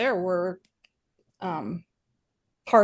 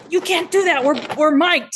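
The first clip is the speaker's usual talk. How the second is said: crew member warning; 8 semitones higher, 5 dB louder than usual. Retold or imitated. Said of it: "And they go".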